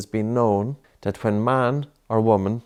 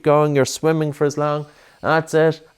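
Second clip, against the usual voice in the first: higher, louder coaching encouragement; urging on; 5 semitones higher, 3 dB louder than usual. Real, not acted. Urging child through breath hold.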